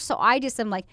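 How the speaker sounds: ordinary delivery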